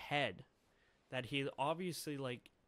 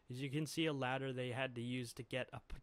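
usual speaking voice

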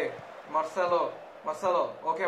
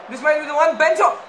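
higher and louder with anticipation; rising excitement; 8 semitones higher, 9 dB louder, faster than usual. Real, not acted. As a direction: build up hope as the chance develops. Attack building toward goal.

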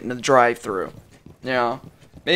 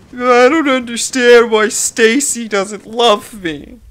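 high, loud, slow whine; hammed-up imitation of a bitter moaner; mock whining; 8 semitones higher, 5 dB louder, slower than usual. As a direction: wail it like a lovelorn complainer, mockingly. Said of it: "they bitch and complain".

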